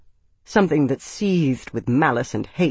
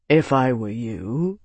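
slow, level, measured warning; calm level tone, taking your time; 3 semitones lower than usual. A narrator's reading. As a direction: keep it even and unhurried. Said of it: "Araris said in a level tone".

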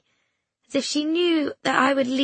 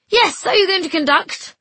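much higher, louder call; 10 semitones higher, 7 dB louder than usual. A narrator's reading. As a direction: shout it from the back row. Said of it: "a voice from the altos called".

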